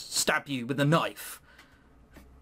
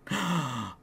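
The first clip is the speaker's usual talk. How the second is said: sharp hammed-up gasp of shock; mock gasp; 4 semitones lower, 4 dB quieter than usual. Bread hero spots a person, mock gasp of alarm. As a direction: gasp as if caught.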